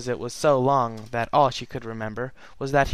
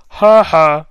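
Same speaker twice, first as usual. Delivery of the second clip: loud, broken half-laugh half-sob; tearful laugh; 4 semitones higher, 16 dB louder than usual. Acted. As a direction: burst out laughing through tears. Celestia sobbing laugh at embrace.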